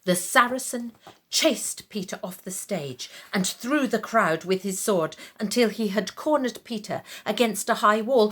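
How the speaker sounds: usual speaking voice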